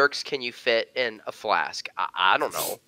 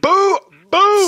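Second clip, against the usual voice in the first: loud, high booing; big high-pitched jeer; heckling boo; 10 dB louder than usual. Pretend. Heckling a Star Wars reference.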